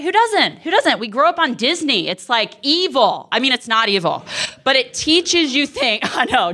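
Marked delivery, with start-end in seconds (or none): 0.0-1.0 high-pitched rhetorical cry; high playful exclamation. Real, not acted. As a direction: toss it up high, teasing.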